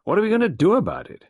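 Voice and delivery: high, loud, fast clipped colonel; Carbery demanding action, high loud colonel; gruff colonel. A narrator's reading. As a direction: bark it like an impatient old soldier.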